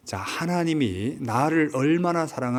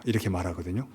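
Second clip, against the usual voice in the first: usual speaking voice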